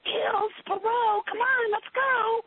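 high, slow, drawn-out call to the dogs. Shown as a demonstration. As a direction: sing the dogs' names up high and slowly. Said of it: "Okay, let's hear it".

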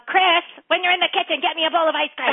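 high, loud ordering; bossy demand. Shown as a demonstration. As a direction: call it out as a sharp order. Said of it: "it'd be different if your mom was going".

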